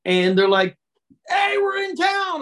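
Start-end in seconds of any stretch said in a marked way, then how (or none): 1.3-2.4 high, slow, cheering greeting; excited shout. Retold or imitated. Friends surprising him at his girlfriend's door, excited shout. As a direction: yell it like old buddies bursting in.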